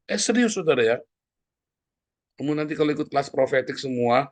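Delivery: usual speaking voice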